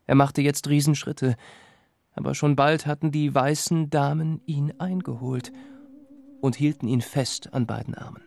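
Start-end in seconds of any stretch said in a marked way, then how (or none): none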